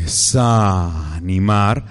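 very slow, low desanimar; slow, sinking desanimar; drawn-out low word. Acted. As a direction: sag the word like losing heart.

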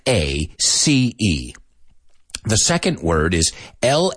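measured spelling for learners; normal-speed spelling. Shown as a demonstration. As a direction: spell each letter clearly at a steady pace. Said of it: "at a more normal speed".

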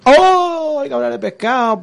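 surprised shout. Real, not acted. Sudden outburst at mention of pescado.

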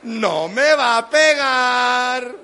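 loud, very slow drawn-out cry; child's stubborn belief. Retold or imitated. Child insists mom won't hit him, loud and drawn-out. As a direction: stretch every word at full volume. Child's thought repeated after mother's yell.

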